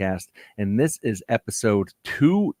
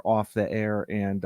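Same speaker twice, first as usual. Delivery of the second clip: usual speaking voice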